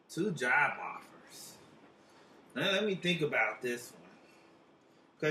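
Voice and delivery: slow, soft mystic musing; slow pondering. Acted. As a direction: mull it over like a psychic. Psychic pondering slowly.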